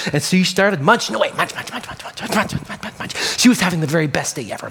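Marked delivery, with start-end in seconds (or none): 1.4-3.4 fading munch sounds, softer. Acted. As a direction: let the munching fade away.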